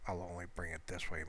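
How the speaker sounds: ordinary talk, nothing marked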